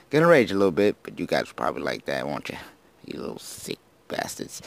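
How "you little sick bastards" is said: whispered teasing jab at viewers; mock sinister whisper. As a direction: hiss it like a playful villain.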